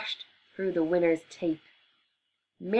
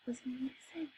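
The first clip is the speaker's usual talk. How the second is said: hushed whisper of a shock; 6 semitones higher, 14 dB quieter than usual. A narrator's reading. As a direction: drop to a whisper for the reveal. Suspense in narration.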